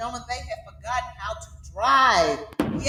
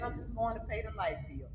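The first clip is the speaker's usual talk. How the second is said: cousin's casual reply, much softer and lower; mumbled reply; 3 semitones lower, 12 dB quieter than usual. Retold or imitated. Cousin casually answering from the roadside, quiet and offhand. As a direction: shrug it off quietly, like it's nothing. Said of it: "He said".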